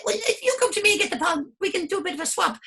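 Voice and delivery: squeaky flower haggling; high flower voice. Acted. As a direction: propose a swap like a cheeky flower. Flower bargaining.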